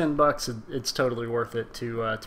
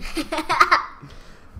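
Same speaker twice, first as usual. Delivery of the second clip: high laugh; 3 dB louder than usual. Real, not acted. Laughing after Cena quote.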